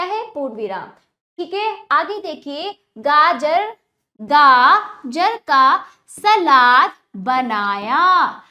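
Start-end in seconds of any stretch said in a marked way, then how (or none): 3.0-8.4 slow drawn-out reading. Shown as a demonstration. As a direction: stretch every आ in आज राजा बाजार, slow and loud. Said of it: "इस तरह आप मेरे साथ उच्चारण कीजिए". (बच्चों के लिए आ खींचकर धीरे और ज़ोर से पढ़ना)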